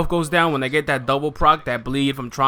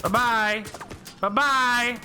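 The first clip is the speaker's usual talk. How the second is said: sing-song taunt, climbing higher; taunting bye-bye; 9 semitones higher, much slower than usual. Real, not acted. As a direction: tease them goodbye, rising each time. Taunting a dying enemy.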